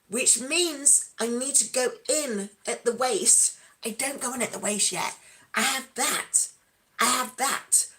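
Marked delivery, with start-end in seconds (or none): 5.5-7.9 a slightly different, cheeky "I have that"; teasing self-pleased aside; playful boast. Real, not acted. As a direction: show off the curves. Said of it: "contrast with I don't have that".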